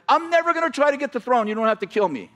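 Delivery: high, fast frantic plea; pleading. Retold or imitated. Lame man begs the king for his life, high and fast. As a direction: rush it out, begging for mercy. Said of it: "he starts begging for his life".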